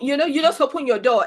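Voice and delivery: ordinary talk, nothing marked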